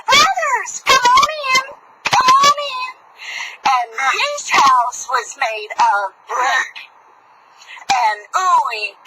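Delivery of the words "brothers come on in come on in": very high cheerful pig voice; welcoming pig. Acted. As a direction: welcome them in high and bright. Brick pig welcomes brothers.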